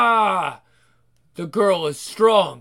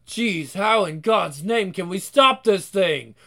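ordinary talk

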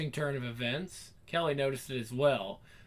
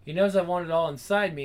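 plain reading voice

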